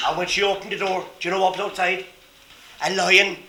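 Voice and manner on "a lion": ordinary delivery, nothing marked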